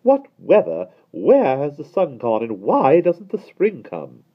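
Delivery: high, loud, slow complaint; prim lady lamenting the winter; fussy lady. A narrator's reading. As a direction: fret about the weather. Miss Lark voiced.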